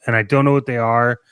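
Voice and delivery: ordinary talk, nothing marked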